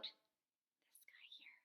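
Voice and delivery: playful whisper. Shown as a demonstration. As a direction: lean in and whisper the question.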